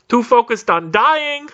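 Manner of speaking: high-pitched, indignant burst; exasperated outburst. Real, not acted. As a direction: throw it out high, in exasperated panic. Exasperated recall of mortal panic.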